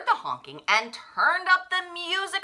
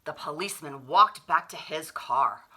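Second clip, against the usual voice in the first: ordinary delivery, nothing marked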